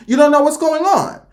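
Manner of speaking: high, lilting repeat after a chuckle; singsong high retort; high-pitched dismissal. Retold or imitated.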